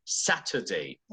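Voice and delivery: "Saturday" low and soft with a true T in the middle; "Saturday" with a crisp middle T; British middle T. Shown as a demonstration. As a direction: say "Saturday" British style, T clearly marked. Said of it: "that is British".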